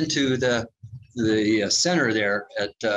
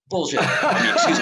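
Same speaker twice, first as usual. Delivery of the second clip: laughing; 6 semitones higher, 3 dB louder than usual. Real, not acted. Laughing at own swear.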